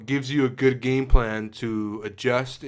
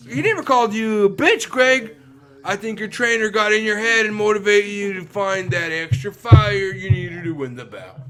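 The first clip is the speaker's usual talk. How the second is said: TV sports announcer; 7 semitones higher, 6 dB louder than usual. Retold or imitated. Announcer wraps up broadcast.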